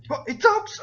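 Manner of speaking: put-on very high, loud kid's exclamation; high-pitched child surprise; astonished boy. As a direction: squeak it out in disbelief as a boy. Boy astonished the slime talks.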